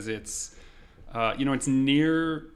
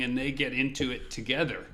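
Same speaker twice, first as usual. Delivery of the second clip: ordinary talk, nothing marked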